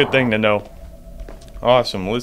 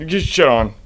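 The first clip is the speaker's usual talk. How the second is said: usual speaking voice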